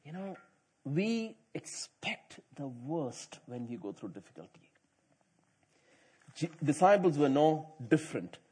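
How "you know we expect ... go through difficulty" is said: breathy aside to the congregation; whisper. Real, not acted. Preacher confides to the church, whispering. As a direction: drop to a whisper for this.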